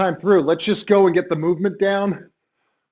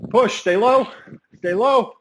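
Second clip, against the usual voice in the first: barking gym coach; 4 semitones higher than usual. Real, not acted. Coach pushing through the drill.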